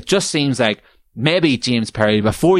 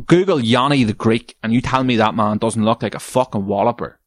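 ordinary talk, nothing marked

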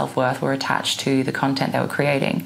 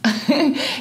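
high laugh; 13 semitones higher than usual. Real, not acted. Laugh at own claim.

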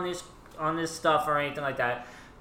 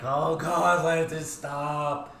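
bored pleading; much slower than usual. Retold or imitated. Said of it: "I'm like".

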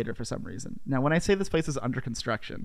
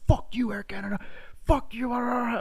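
imitating furious swearing; 9 semitones higher, 4 dB quieter than usual. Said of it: "freaking out, like, just swearing".

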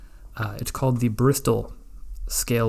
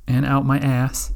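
ordinary talk

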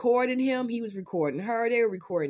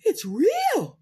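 high shocked cry; shocked gasp; 8 semitones higher, 4 dB louder than usual. Real, not acted.

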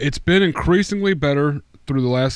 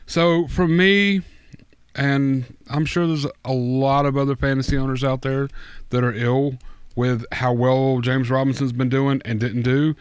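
normal talking voice

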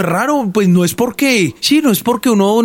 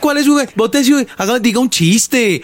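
mock guard; 5 semitones higher than usual. Guard challenging tiktoker.

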